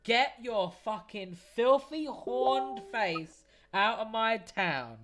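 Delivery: slow sneering threat from the town guards; hostile guards snarling. Acted. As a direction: spit it at the horned stranger. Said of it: "they both look at you and they go".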